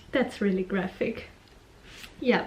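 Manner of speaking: ordinary talk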